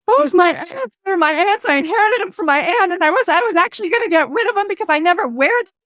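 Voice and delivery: imitating a tearful wife. Wife overwhelmed by her aunt's message, imitated tearful outburst. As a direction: voice the wife as she breaks down, half-played. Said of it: "the woman starts crying".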